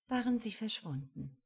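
plain reading voice

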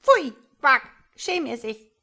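loud, high scolding frog with a quack; shouting. A narrator's reading. Frog mother scolds her tadpole, shouting. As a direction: cry out in disgust. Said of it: "schrie der Frosch".

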